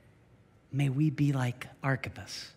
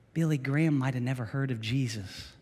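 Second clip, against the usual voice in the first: usual speaking voice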